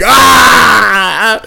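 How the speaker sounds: loud, high mock yell of Ah; retold yell. Friend acting out his yell at the parents. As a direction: yell it out like the scene he's picturing. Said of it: "all your parents hear is me yelling".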